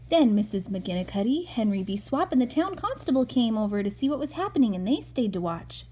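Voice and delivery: gentle storybook narration read aloud; calm read-aloud for a little boy; reading to a child. Acted. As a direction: read it like a bedtime picture book. Mother reads picture book aloud.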